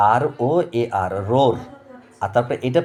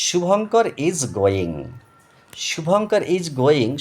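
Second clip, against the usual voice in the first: "Shubhankar is going" slow and higher, the name stressed; higher, slow teacher dictation; slow model reading; 4 semitones higher, much slower than usual. Shown as a demonstration. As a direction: read "Shubhankar is going" slowly, lifting "Shubhankar". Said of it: "এবার দেখো".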